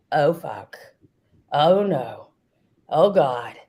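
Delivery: low, falling dismayed asides; acted sinking realisation; mock dread. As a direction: let each one sink with dread. Acting Allison's dread at being named.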